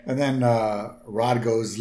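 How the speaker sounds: ordinary talk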